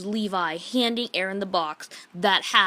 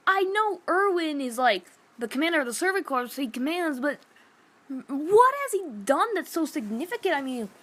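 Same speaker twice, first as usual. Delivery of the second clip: worked-up rant; 7 semitones higher than usual. Real, not acted. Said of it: "I am just so surprised".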